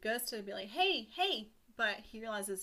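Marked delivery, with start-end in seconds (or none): 0.7-1.5 high-pitched mock call for attention; raised, urgent storytelling shout. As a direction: call out to get someone's attention. Pinocchio calls out to farmer.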